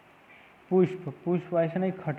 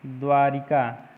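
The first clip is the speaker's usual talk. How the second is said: ordinary talk, nothing marked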